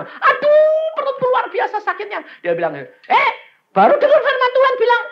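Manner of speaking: high, shouted sermon storytelling; full-force preaching. Acted. Preacher retelling a woman's painful healing, high excited shouting. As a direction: act out the tale at a fever pitch.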